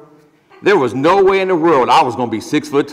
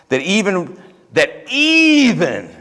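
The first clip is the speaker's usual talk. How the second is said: even swung much higher and slow; emphatic even; 5 semitones higher, slower than usual. Real, not acted. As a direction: draw out the word even, rising high. Preacher repeats with rising emphasis.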